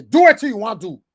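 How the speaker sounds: defiant quote. Retold or imitated.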